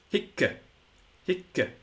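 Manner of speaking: hic, short i and held double c; doubled final consonant. Shown as a demonstration. Teacher showing a sound English lacks, doubled final consonant. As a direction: say each hic as "hikk-uh", set apart. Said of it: "double syllable at the end".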